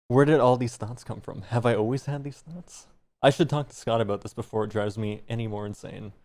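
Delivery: usual speaking voice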